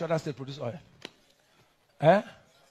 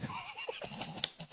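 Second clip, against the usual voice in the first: soft laugh; 17 dB quieter than usual. Real, not acted. Laughs about testing kits.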